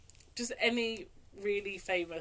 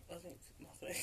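whispering; 2 semitones lower, 13 dB quieter than usual. Real, not acted. Whispered reply.

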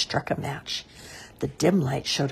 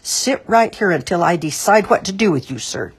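loud, raised order dropping on the last word; ringing command; 4 semitones higher, 7 dB louder than usual. A narrator's reading. The sheriff stops Mister Grape, voice ringing out. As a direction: let the order ring out. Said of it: "voice rang out".

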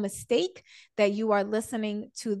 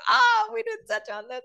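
excited realization; 12 semitones higher, 4 dB louder than usual. Retold or imitated. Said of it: "I'm like".

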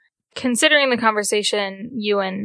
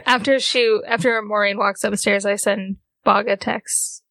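ordinary talk

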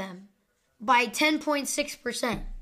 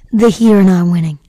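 much louder, blunt verdict; loud declaration; 3 semitones lower, 16 dB louder than usual. Real, not acted. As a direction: announce it loudly to the room. Reacting to the score.

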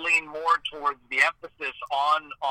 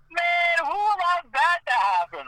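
higher-pitched incredulous gripe; exasperated disbelief; 13 semitones higher than usual. Retold or imitated. Player griping about a name in the game, exasperated. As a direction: complain in baffled, drawn-out disbelief. Voices his old reaction as player.

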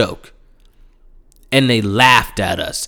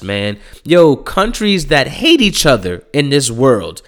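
ordinary talk, nothing marked

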